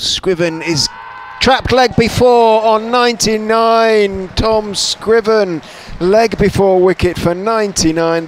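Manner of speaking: rising, loud live cricket call; excited wicket call. Real, not acted. Wicket falls on ninety-nine, live call.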